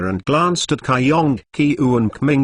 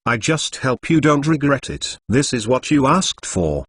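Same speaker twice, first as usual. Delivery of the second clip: ordinary delivery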